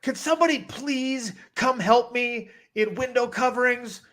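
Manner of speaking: high-pitched imitation of a flustered clerk; store intercom plea. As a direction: call plaintively over the store intercom. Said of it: "on the little, like, intercom".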